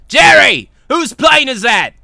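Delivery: loud, high shouted question to an underling; calling out. Acted. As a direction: bellow for your assistant. Boss calls out to Jerry.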